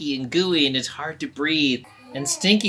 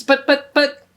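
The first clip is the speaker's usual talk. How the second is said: much higher, louder sputtering; stammering protest; 11 semitones higher, 8 dB louder than usual. Acted. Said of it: "And Stinky's".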